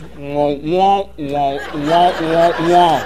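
adult cartoon wah-wah noise, mocking; muffled wah-wah babble. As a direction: make the sound of grown-up talk a kid tunes out. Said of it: "all he hears is".

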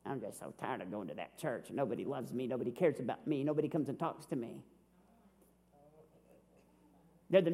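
sulky complainer. Shown as a demonstration. Pastor mocks a bitter member complaining about church, in a low sulky whine. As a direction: drag it out like a sulking churchgoer feeling unloved. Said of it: "Here's another expression of faith that doesn't work".

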